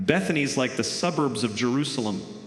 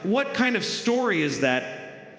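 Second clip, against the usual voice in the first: ordinary talk, nothing marked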